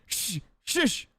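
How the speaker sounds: soft, hissed mock shush; retold "shh" to silence a witness; imitated shushing. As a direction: shush someone, imitating a curt lawyer. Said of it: "He shushed her".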